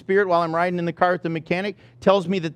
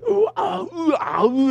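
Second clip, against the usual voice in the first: higher anguished ooh-ah moaning; restless groans; 8 semitones higher than usual. Retold or imitated. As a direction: groan back and forth in distress. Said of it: "I'm like".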